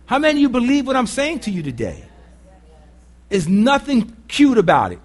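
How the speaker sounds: ordinary talk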